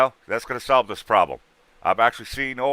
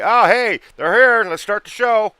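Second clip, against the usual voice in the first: mock spirit voice; 11 semitones higher, 8 dB louder, slower than usual. Mocking spirits showing up.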